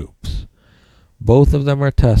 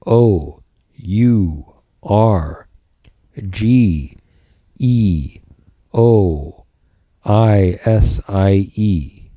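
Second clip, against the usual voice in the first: slow spelling out; much slower than usual. Shown as a demonstration. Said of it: "this is spelled".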